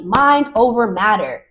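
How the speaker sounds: ordinary delivery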